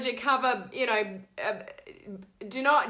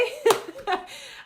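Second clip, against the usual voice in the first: high laugh; 13 semitones higher than usual. Real, not acted. Laughs after her message.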